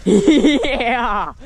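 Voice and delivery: high whoop into laughter; triumphant laugh. Real, not acted. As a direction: crow with laughter over the hit. Triumphant yell then laughing.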